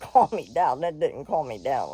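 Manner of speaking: usual speaking voice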